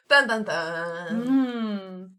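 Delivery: slow, drawn-out "dun dun dun"; dramatic sting. Pretend. Player marks a colleague's reveal, mock-dramatic sting. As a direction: sing out a mock suspense sting.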